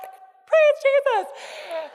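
very high, loud, slow joyful cry with a laugh; excited outburst. Retold or imitated. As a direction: burst out with delighted praise. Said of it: "But I was just so excited".

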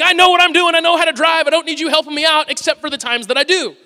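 exasperated protest, high and loud; acted-out frustration, pushed high; frustrated. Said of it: "I get frustrated and I'm like".

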